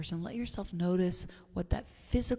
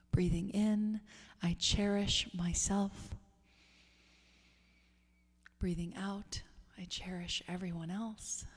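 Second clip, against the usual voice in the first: softer on every out-breath, leaving room to breathe; guided breathing meditation; 4 dB quieter than usual. Real, not acted. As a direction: lead a hushed, spacious breath mantra.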